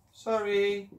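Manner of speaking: put-on high sheepish child's sorry. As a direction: say sorry like a caught kid. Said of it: "replied the children".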